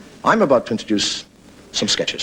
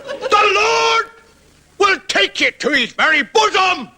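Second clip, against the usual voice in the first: very high shouted hellfire sermon; Paisley preaching. Retold or imitated. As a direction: bellow the promise of heaven. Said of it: "and he talks like that".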